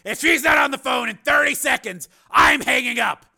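high, loud, forceful threat; angry ultimatum. A narrator's reading. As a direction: lay down an ultimatum at full volume. Steele threatens to hang up.

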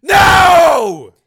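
high, very loud, drawn-out cry; horrified shout. Acted. Father sees daughter fall, horrified shout. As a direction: bellow in horror.

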